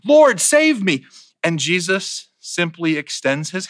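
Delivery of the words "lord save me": high, loud, slow plea for rescue; desperate cry. Acted. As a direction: cry out as if sinking. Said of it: "he cries out".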